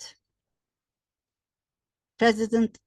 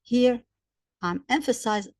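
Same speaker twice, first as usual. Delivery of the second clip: ordinary talk, nothing marked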